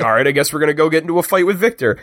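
fast, weary mock aside; quick deadpan resignation; mock resigned. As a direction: rattle it off like a shrug. Mock Logan resigned quote.

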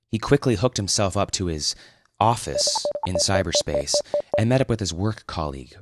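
usual speaking voice

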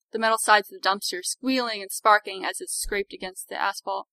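plain reading voice

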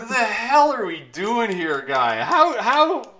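high, loud disbelief; exasperated rant. Retold or imitated. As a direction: throw your hands up, incredulous.

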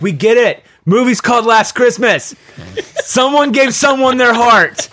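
loud, high-pitched sarcastic rant; mock exasperation. Viewer heckling the movie's heavy-handed twist, mock exasperation. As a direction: hammer the obvious, half laughing. Mocks the film's obvious song cue.

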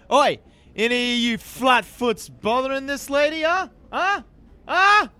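passionate bellow into a crowd; loud, confrontational shout; very loud yell. Acted. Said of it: "I yell very loudly, with much passion".

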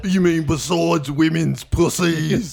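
slow boxer voice; deadpan slow boxer answer; mock Mike Tyson. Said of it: "Mike Tyson?".

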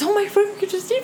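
hint of a frightened boy breaking; lightly voiced hurt child, squeaking at the end; wounded little boy. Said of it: "he's got wounded little boy come up".

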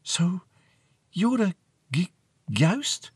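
tentative, halting disbelief; hesitant awed girl. A narrator's reading. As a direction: stammer on the last word, unsure. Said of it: "Marianne whispered".

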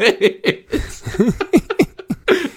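high giggling fit; embarrassed laughter. Real, not acted. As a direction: laugh at yourself. Laughing at own mistake.

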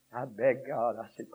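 usual speaking voice

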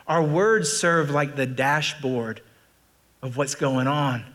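normal talking voice

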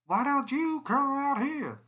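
high, loud and slow, booming through a speaker; megaphone-projected female voice; projected over a loudspeaker. Acted. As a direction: call out through the loudspeaker to the trailer. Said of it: "a female voice projected".

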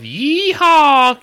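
very slow stretched yeehaw; drawn-out announcement. Acted. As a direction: stretch it like a drumroll. Drawn out beer name.

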